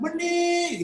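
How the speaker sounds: drawn-out high calling shout; imitating Daus calling out to Meni, long and high. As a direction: call her name long and high across the house. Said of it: "ternyata panjang".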